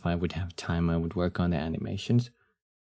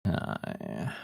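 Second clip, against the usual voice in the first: low, soft, husky hi; deep morning voice; 6 semitones lower, 8 dB quieter than usual. Shown as a demonstration. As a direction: drop into a sleepy morning rasp. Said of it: "Morning voice".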